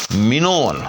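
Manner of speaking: منون said slowly as minu-an, with a single n and no shadda; slow correct demo of منون; correct pronunciation. Shown as a demonstration. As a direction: give the right منون carefully. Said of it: "تو درست تلفظ اس کا".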